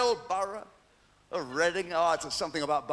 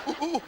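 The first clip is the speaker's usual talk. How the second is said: high, laughing mock moans; hammed-up ooh sounds of lovemaking; 11 semitones higher, faster than usual. Mimicking sex noises.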